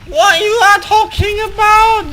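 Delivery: high, loud, incredulous shout; outraged yell. Real, not acted. Gamer shouting back at a teammate, high outraged yell. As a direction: blow up at the teammate in disbelief.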